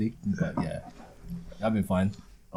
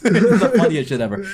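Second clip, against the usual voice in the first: ordinary talk, nothing marked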